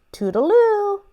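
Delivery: sing-song goodbye. Acted. Peacock's high goodbye.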